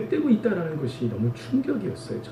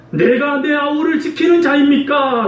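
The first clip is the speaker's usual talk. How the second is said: slow, higher and loud, a grumbling retort; blunt complaint; 9 semitones higher, 4 dB louder, slower than usual. Retold or imitated. Pastor acting his own blunt grumbling prayer to God. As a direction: throw "지키는" out bitterly, as if God asked too much. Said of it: "퉁명스럽게 하나님께 불만만 터뜨리고".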